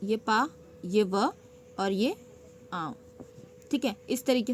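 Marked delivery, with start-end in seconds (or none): 0.0-3.0 very slow, splitting पाँव into पा and आँव; slow syllable breakdown. Shown as a demonstration. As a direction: spell out each piece with pauses. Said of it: "simple पहले पाँव".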